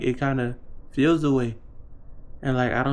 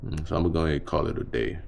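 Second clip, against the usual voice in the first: sexy man voice; 8 semitones lower than usual. Pretend. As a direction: drop into a low, seductive man voice. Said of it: "we in the sexy man voice mode".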